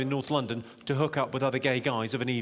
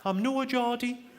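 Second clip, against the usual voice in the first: high-pitched bad Sunderland accent; terrible Geordie accent; 7 semitones higher than usual. Barmaid from Sunderland answers an insult, bad Geordie accent. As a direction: put on a bad, high north-east English accent. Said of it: "Sorry, that's a terrible accent".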